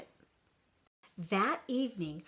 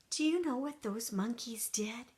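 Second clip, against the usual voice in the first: ordinary delivery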